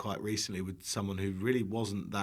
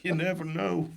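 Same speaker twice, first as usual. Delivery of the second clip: lightly voiced film character, pitched up; Forrest Gump; 5 semitones higher than usual. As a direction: parody a famous movie line through laughter. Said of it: "It's Forrest Gump".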